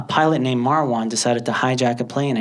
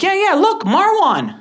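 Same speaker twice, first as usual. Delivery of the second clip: put-on high, loud, eager kid; excited showing off; 5 dB louder, slower than usual. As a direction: urge everyone to look, pitched high and proud. Kid proudly shows classmates the TV.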